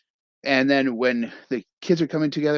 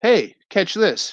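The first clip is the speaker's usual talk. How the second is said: coach's call; 9 semitones higher, 6 dB louder, slower than usual. Retold or imitated. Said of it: "he's told".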